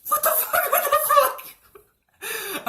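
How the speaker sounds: very high mock-panicked squeal; shrieky disbelief tipping into laughter. Patient told to drop his shorts, panicked squeal. Panicked reaction to doctor's request.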